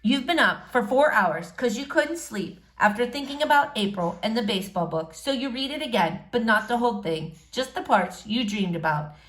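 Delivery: ordinary delivery, nothing marked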